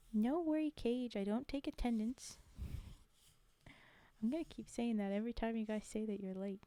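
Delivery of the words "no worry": usual speaking voice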